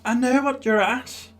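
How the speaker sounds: high-pitched host impersonation. Retold or imitated. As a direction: put on a high, accusing presenter's voice. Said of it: "she says".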